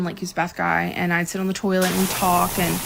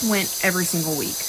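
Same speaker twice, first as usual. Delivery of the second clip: ordinary talk, nothing marked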